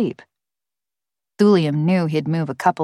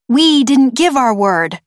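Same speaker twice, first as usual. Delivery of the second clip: louder, higher, slow indignant retort; angry; 7 semitones higher, 5 dB louder, slower than usual. A narrator's reading. Young scientist denying she gave her word, angry. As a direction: push back hard at the accusation. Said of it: "sounding angry".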